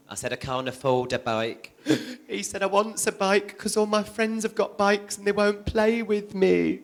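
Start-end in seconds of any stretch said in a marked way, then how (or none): 0.3-1.7 softer, sorry mum; soft refusal. Retold or imitated. Mother tells her son she can't afford a bike: soft, sorry. 2.6-6.8 put-on higher, softer whining little boy; whiny child. Son begs his mum for a bike: higher, softer whine.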